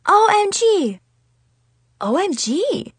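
high-pitched exclamation of shock; can't believe your eyes, pitch way up; acted astonished outburst. A narrator's reading. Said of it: "could not believe our eyes".

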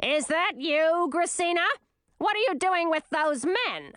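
high, querulous queen calling out; put-on high voice, demanding answers; mother calling out. A narrator's reading. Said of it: "until Olivine called out".

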